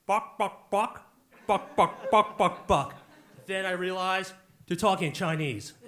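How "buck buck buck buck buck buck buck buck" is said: choppy, loud mock babble; mimicking foreign-sounding code. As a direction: punch out each identical syllable as imitated chatter. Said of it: "it kinda sounds like this".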